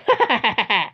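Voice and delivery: high cartoon villain cackle; evil laugh. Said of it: "con una risa malvada".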